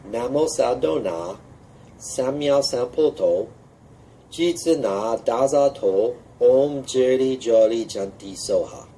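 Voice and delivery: slow, careful mantra recitation; measured teaching chant; slow mantra demo. Shown as a demonstration. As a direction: lay out each syllable slowly. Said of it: "the sound for the Janti mantra goes as follows".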